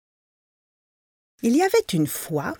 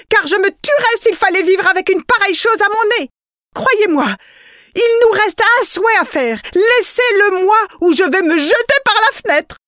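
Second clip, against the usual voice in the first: high and loud, frantic; desperate protest; 9 semitones higher, 5 dB louder than usual. A narrator's reading. Wife refusing the golden case, high and frantic. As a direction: cry him down in panic.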